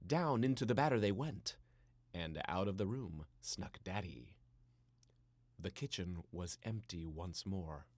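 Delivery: usual speaking voice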